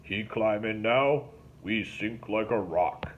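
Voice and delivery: slow, patient boatman voice; lightly voiced swamp boatman, explaining; slow, deliberate explanation; Link explaining. A narrator's reading. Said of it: "Link explained".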